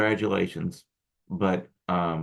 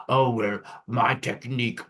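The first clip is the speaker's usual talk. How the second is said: put-on Austrian accent. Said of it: "I'm using an accent like he had".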